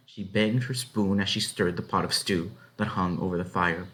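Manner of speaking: plain reading voice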